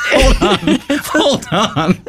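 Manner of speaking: laughing. Real, not acted. Laughing in disbelief.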